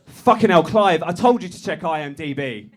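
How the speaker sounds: loud, high exasperated telling-off; mock angry boss. [name] blowing up at Clive, loud and high. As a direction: snap at the junior through a laugh.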